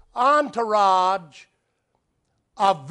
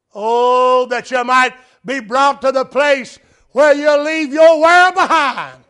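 high, loud, fervent exhortation; impassioned preacher at full volume; full-force preaching; 6 semitones higher, 11 dB louder than usual. Real, not acted. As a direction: cry it out to the congregation, stretching the end.

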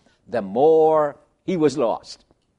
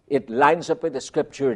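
ordinary delivery

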